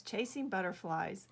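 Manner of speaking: usual speaking voice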